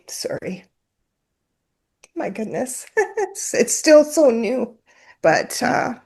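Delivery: real tears breaking through a shaky laugh; choked up, near tears. Real, not acted. Apologizing to the host, choking back real tears. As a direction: voice catching as grief wells up. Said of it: "It's a little bit raw still".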